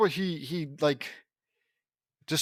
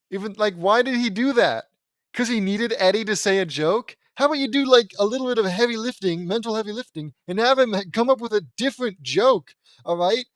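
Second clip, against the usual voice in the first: loud, higher-pitched exasperation; exasperated rant; 6 semitones higher, 6 dB louder than usual. Real, not acted. Reader berating the writer for copying a joke, exasperated rant. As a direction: scold the absent writer, loud and high.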